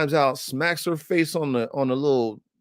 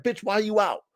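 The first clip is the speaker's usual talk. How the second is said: high-pitched kennel-mate question; voicing the dogs; 8 semitones higher than usual. Dogs looking at the escaped one, high mock-baffled voice. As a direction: play the dogs, baffled by the runaway. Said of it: "They looking at her like".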